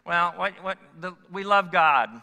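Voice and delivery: playful imitation of a Sunday-school answer; silly mock voice. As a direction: mimic the churchy know-it-all kid. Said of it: "I don't know why I'm making that voice".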